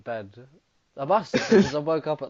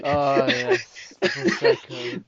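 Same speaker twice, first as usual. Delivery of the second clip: mock excitement; 7 dB louder, much slower than usual. Mock delight at the Prosecco.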